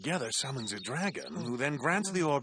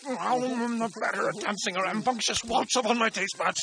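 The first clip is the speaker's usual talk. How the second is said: rapturous foodie; 5 semitones higher than usual. Acted. Savoring food, rapturous.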